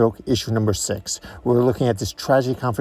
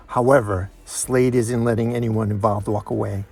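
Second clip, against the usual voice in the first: ordinary delivery, nothing marked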